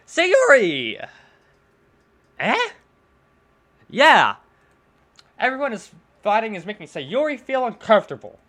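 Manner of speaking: put-on loud, bright girl voice; loud cheerful girl. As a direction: call out like an upset cheerful girl. Sayori calling out.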